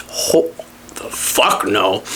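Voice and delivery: low slow whispered refusal; whispered disgust. Real, not acted. Hearing her room is by the garage, whispered disgust. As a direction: breathe the refusal out flatly.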